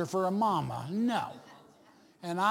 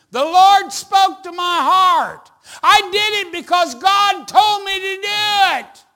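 bold testimony; 13 semitones higher, 16 dB louder than usual. Retold or imitated. Churchgoer testifying that God spoke, fervent shout. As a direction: proclaim it fervently to the whole church.

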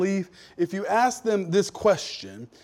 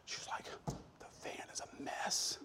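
hushed, embarrassed whisper; wife whispering to her husband about the messy van; 17 dB quieter than usual. Retold or imitated. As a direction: whisper it so the guest won't hear. Embarrassed wife hiding the mess.

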